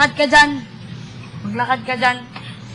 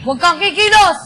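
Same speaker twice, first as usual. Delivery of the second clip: police command; 4 semitones higher, 4 dB louder than usual. Acted. Police ordering suspect.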